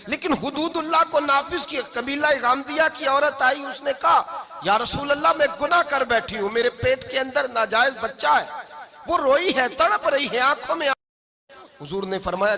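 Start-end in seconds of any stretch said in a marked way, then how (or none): 0.1-10.9 dramatic sermon storytelling, pitched high; impassioned preaching. Acted. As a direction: tell it urgently in a raised preacher's voice. (ऊँची आवाज़ में जोशीला बयान)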